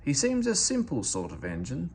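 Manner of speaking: usual speaking voice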